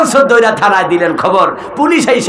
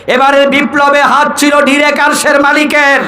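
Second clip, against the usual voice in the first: higher, declaiming sermon voice; fervent preaching; 8 semitones higher than usual. Real, not acted. Preacher hails the August revolution to brothers, fervent preaching. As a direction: proclaim it to the whole crowd.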